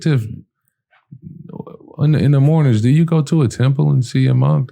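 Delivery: fast high excited question; eager question. Retold or imitated. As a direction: ask her fast, can't wait. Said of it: "I said".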